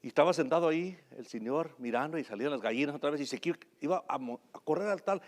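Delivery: normal talking voice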